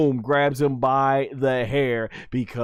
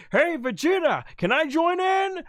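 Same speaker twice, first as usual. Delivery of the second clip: high-pitched mock calling out; imitating a yell back. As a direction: call to a friend from far off. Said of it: "he's yelling back".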